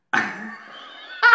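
sudden high burst of laughter. Real, not acted. Laugh before the reveal.

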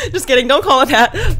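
real laugh, then a loud laughing take-back. Real, not acted. Vlogger laughs off her own joke to viewers, laughing loudly. Jokes about industry plant incubator.

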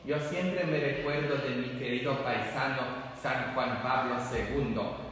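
ordinary delivery

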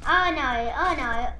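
ordinary talk

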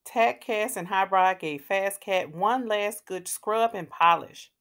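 plain reading voice

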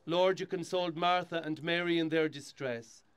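steady, solemn prayer reading; hushed graveside prayer. Acted. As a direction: lead the mourners in prayer. Priest leads graveside burial rite.

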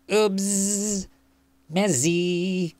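high little fly with drawn-out buzzy sounds; high, droning insect voice; buzzing fly voice. A narrator's reading. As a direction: talk like a fly, stretching the buzz. Fly comments before washing.

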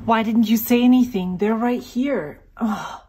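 loud exasperated complaint; fed up, scolding the room. Real, not acted. Found missing samples, exasperated.

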